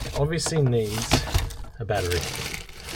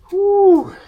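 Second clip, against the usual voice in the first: loud exhale; 11 dB louder than usual. Real, not acted. Pause after hard work.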